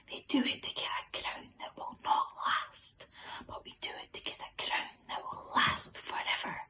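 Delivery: hushed, whispered verse recitation; very quiet whisper. Shown as a demonstration. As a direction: whisper it as quietly as you can. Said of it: "I'm gonna say it very quietly".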